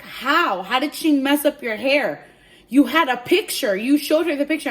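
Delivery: loud, emphatic incredulous questioning; shocked disbelief. Retold or imitated. Friend hears of botched hair, shocked disbelief. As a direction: imitate a friend's astonished protest.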